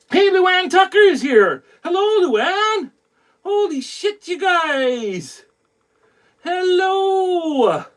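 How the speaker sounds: very high, drawn-out delighted hello; excited greeting. Real, not acted. Host thrilled as viewer joins the stream, excited greeting. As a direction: sing out the welcome high and stretched.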